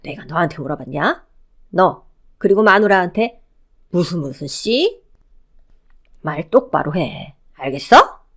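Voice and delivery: stern mother-in-law putting down a newcomer; stern scolding. Acted. Mother-in-law correcting her new daughter-in-law, stern scolding. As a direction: scold slowly, dropping low, then sharp on the last demand.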